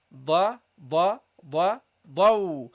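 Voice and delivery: da and daw said heavy and emphatic; teacher drills the letter dad. Shown as a demonstration. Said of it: "the dot, you go here".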